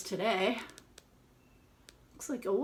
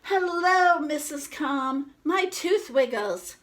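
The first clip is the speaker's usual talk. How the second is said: put-on higher, louder kid voice; excited little girl; 7 semitones higher, 8 dB louder than usual. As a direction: call out happily as the girl. Girl character greets old shopkeeper.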